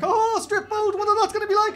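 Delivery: hammed-up overexcited groom; Will, excited. Stag hearing about the strip boat, hammed-up excitement. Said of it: "the stag was quite excited".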